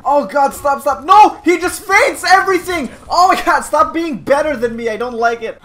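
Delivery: high, loud frantic complaint; overwhelmed gamer yelling; exasperated protest. Real, not acted. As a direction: cry out for the opponent to stop. Losing, protests at opponent.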